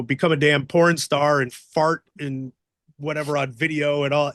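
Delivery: ordinary talk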